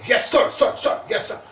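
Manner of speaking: high shouted replies, easing at the end; imitated shouting. Medium acts out the lowest-ranked man, shouting high and loud. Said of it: "That's how he's shouting".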